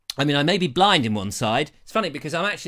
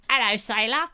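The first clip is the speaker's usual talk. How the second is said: higher, teasing come-on voice; flirty put-on greeting; 6 semitones higher than usual. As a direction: play a saucy passer-by calling out.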